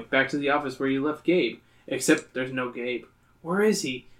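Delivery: ordinary delivery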